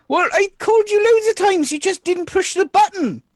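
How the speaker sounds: high, lightly voiced, innocent-sounding; lying son. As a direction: fib sweetly to his mum.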